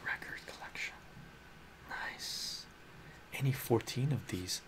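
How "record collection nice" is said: hushed unvoiced aside; quiet conspiratorial whisper. Real, not acted. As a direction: whisper it close to the mic.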